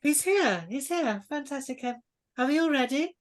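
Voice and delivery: high, piercing hostess voice; Lady Sletney. Acted. Hostess checking everyone is ready for the bard, piercing. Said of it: "the piercing voice of Lady Sletney".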